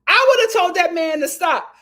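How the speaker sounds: loud laughing outburst. Real, not acted. Laughing outburst about song.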